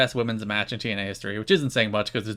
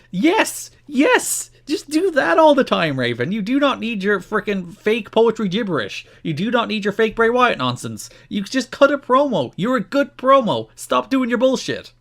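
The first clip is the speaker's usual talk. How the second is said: high, louder fan pleading with a wrestler; excited rant; 10 semitones higher, 4 dB louder than usual. Real, not acted. Podcaster yelling at Raven to just cut promos, excited. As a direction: cheer him on, then scold him hard.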